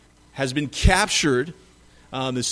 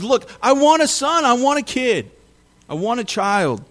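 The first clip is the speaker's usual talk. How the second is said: higher and louder at first, fading to a plain plea; pleading demand for a son; 7 semitones higher, 3 dB louder than usual. Retold or imitated. Abraham insists to God that he wants a child, loud pleading fading. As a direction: plead hard, then let it sink.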